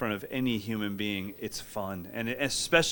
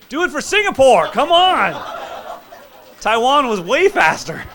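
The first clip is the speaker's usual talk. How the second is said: much higher, much louder yelling; screaming cheer; 14 semitones higher, 11 dB louder than usual. Real, not acted. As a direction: scream them on to the finish. Coach screaming encouragement mid-drill.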